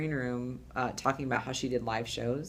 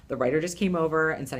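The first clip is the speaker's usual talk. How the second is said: normal talking voice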